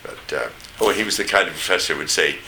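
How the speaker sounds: ordinary talk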